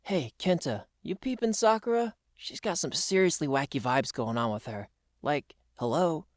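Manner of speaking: low, hushed American dude; confidential aside. Acted. Dude asking Kenta about Sakura's wacky vibes, hushed aside. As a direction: drop it down to a puzzled aside.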